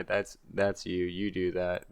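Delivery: normal talking voice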